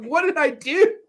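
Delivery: a bewildered dog asking what it did wrong; mock dog voice. Retelling the family dog's bewildered reply, in a mock high dog voice. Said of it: "the poor dog's like".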